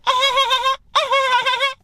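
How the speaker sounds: very high-pitched imitated cackle; mock laugh. Girl laughs at his approach, high mocking laugh.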